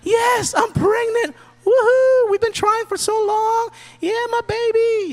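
high, squealing mock joy; high excited cheer of a newly pregnant woman. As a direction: squeal it like a thrilled mom-to-be. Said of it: "she's, like, so excited".

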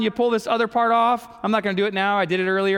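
normal talking voice

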